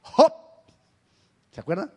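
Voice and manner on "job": sudden loud, high yelp; one short burst, like driving a horse; horse-driver's call. Shown as a demonstration. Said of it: "No es que esté arriando un caballo".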